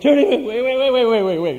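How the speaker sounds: high, loud comic flurry of repeats; mock frantic stammer. Businessman hears thirty million dollars, mock frantic. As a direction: trip over it in comic panic, half laughing.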